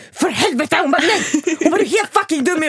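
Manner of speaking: high-pitched, outraged mock outburst; panicked mother. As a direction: imitate a panicking mother scolding, pitch jumping up. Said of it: "hon fick panik". (härmar en panikslagen mamma)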